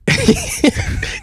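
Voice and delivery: very high auto-tuned giggle; squeaky giggly laugh. Real, not acted. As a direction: chipmunk giggle through effect.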